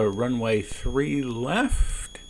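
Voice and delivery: ordinary talk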